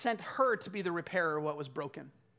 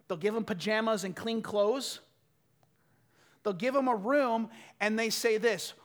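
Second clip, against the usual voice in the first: normal talking voice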